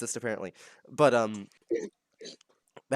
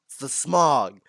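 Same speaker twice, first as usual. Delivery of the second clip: louder mock complaint about the smog; Jay Schlatt bit; 8 dB louder, slower than usual. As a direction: punch "smog" like quoting a comedian's bit. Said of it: "Jay Schlatt reference".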